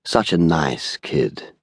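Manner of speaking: slow, smooth English voice; understated, slow mock regret. A narrator's reading. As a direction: draw it out smoothly and softly. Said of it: "said smoothly".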